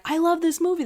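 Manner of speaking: high, gushing mock confession; imitated fan enthusiasm. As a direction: blurt it out brightly as a delighted fan. Acting out fans confessing their love.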